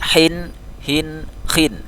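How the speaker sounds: "Hin, hin, khin": sharp, rounded, then throaty; held "Hin, hin, khin", each h distinct; three contrasting h letters. Shown as a demonstration. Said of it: "dia agak berbunyi tenggorokan".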